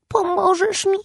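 put-on high, hopeful small boy asking; hopeful little boy. A narrator's reading. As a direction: ask hoping for help. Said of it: "z nadzieją spytał malec". (z nadzieją, wysokim głosem malca)

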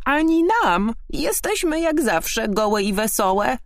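very high, merry frog voice; croaking frogs. A narrator's reading. Frogs boasting they own nothing, high croaking voice. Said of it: "zarechotały żaby".

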